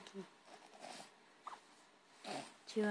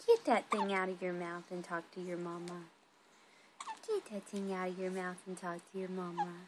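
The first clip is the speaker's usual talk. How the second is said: ordinary talk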